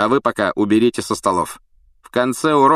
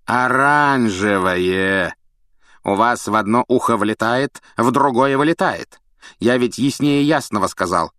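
cold teacher scolding a fool; scornful scolding; 5 semitones higher, 4 dB louder than usual. Acted. Snape berates Neville.

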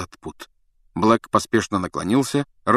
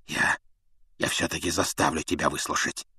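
hoarse; 4 semitones lower, 7 dB quieter than usual. A narrator's reading. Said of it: "хрипло проговорил он".

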